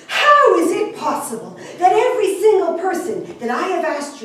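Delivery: loud, indignant demand, high at the peaks; outraged. A narrator's reading. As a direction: confront your guest and demand an explanation. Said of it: "He was outraged".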